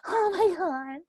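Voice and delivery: high, loud stunned gasp; mock shocked teen. Aunt imitating her nephew after the slap, high shocked. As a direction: play the boy reeling from the slap. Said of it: "he was all".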